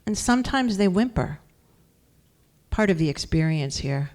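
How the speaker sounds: measured poem recital with long pauses between lines; poetry reading. Acted. Poet reads from her first book to an audience, measured recital. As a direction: give each line its own space, firmly voiced.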